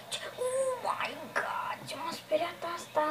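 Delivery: hushed, breathless whisper after a fright; startled whisper. Real, not acted. Streamer spooked by the game, whispers to viewers. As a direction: whisper it like you just got spooked.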